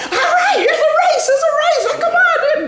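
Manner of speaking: high, loud, laughing hype of the race; excited instigating. Retold or imitated. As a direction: uncles egging on the race. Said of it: "they was like".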